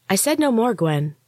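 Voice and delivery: icy, clipped warning, pitched high on the first words; cold. A narrator's reading. Kaya silences Gwen with a cold command. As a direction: shut her down coldly. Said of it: "Kaya said coldly".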